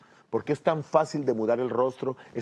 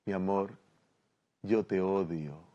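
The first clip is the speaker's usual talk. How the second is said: loving tenderness; 5 semitones lower, 7 dB quieter than usual. Acted. Wife gazing at husband after a soft kiss, tender. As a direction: say hateful words as a declaration of love. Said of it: "le está diciendo "te amo".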